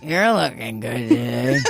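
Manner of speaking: ventriloquist style, lips still; talking with mouth closed. Shown as a demonstration. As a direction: say it without moving your mouth. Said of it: "you can say it without moving your mouth".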